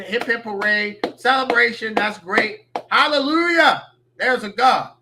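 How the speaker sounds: high, loud sarcastic cheering; hammed-up rejoicing, peaking on a loud hallelujah; mock celebration. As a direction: cheer like it's a miracle, mockingly. Mocking fans celebrating the firing.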